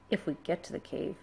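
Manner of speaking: softly nervous, worried voice; a nervous boy eyeing the cliff. A narrator's reading. Hiccup nervously eyes the sheer cliff, a soft worried line. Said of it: "said Hiccup nervously".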